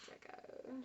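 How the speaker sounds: breathy whispered Draco; whispered name. Shown as a demonstration. Last gothic try on Draco, whispered. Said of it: "Do your best gothic voice for me".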